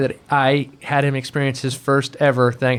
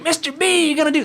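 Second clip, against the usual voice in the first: very high, sing-song call; hammed-up commercial character calling Mister B; Ameritrade guy; 14 semitones higher than usual. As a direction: greet the boss like a goofy ad character. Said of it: "he'd come in the office like".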